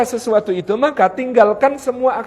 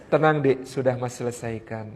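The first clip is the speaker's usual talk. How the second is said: low, calm husband trailing softer; suami menenangkan; 9 semitones lower, 7 dB quieter than usual. Acted. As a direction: quietly reassure the wife it is handled. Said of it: "itu cara suami cerita". (suara suami tenang, rendah dan lembut)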